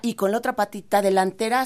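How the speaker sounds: ordinary delivery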